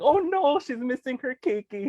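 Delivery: retold startled "oh no"; dismayed surprise. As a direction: gasp in mock dismay. Sees kumu's son on screen.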